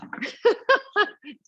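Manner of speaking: high burst of laughter; real laugh. Real, not acted. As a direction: laugh out at how tough that was. Laughs at the hard exercise.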